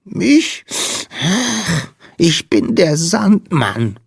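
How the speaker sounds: high, slow, tearful squeak; sniff, then introduce yourself in a tiny sad voice; small creature's sniffly high voice; sniffling little sandman. A narrator's reading. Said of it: "schniefte der Wicht".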